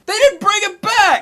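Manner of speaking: very high, loud, drawn-out mock protest; outraged yell. Fan retelling his shock at the movie's ending, outraged yell.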